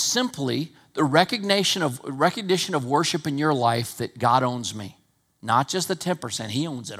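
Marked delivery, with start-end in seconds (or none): none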